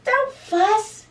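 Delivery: high, loud little mouse voice; high squeak easing into eager reassurance; squeaky mouse. A narrator's reading. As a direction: squeak it out to comfort a friend. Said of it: "Mouse squeaks".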